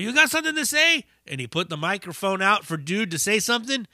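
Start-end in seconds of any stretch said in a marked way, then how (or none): 0.0-1.0 high, fast mock dare; imitating the singer. Singer taunting the heckler, high mock dare. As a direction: taunt him as if into a mic. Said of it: "he's like".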